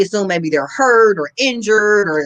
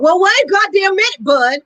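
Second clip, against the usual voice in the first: put-on Southern accent; 12 semitones higher, 4 dB louder than usual. Pretending to be Southern to a Texan, mock Southern accent. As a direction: ham up a Southern good old boy. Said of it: "turn on my Southern accent".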